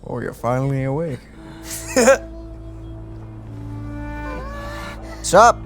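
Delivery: ordinary talk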